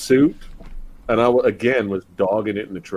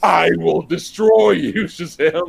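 slow, higher hammy villain threat; megalomaniac rant; 5 semitones higher, slower than usual. Retold or imitated. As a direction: declare it like a distant supervillain. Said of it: "far away giving villain speech".

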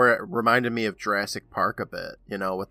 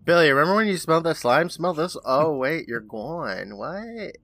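Sheena, mock puzzlement; 4 semitones higher than usual. Imitating Sheena finding Billy gone, eager then baffled. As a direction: start eager, drop away, end on a small high bewildered squeak.

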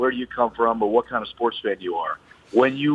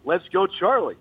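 raised-pitch mock yell, no extra volume; half-hearted retold cheer, pitched up; 8 semitones higher than usual. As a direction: imitate a fan's cheer without really shouting. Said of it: "I was yelling".

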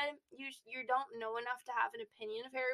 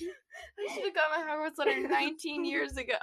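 laughing; 3 semitones higher, 5 dB louder than usual. Real, not acted. Laughing fit.

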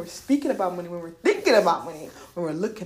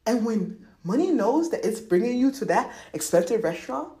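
normal talking voice

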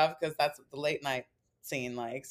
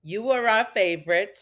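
normal talking voice

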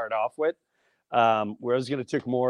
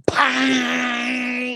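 shouted bang. Pretend. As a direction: hit the sound hard. Imagining the punch.

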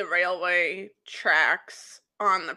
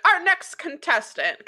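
higher, hint of a show announcer; lightly voiced announcer; 9 semitones higher than usual. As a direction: lightly announce the next act. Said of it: "And so then they're like".

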